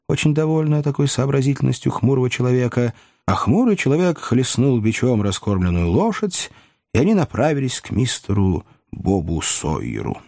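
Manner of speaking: plain reading voice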